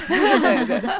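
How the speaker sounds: laughing through the word. Real, not acted. App maker laughing about the listeners' wish list. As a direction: giggle while agreeing.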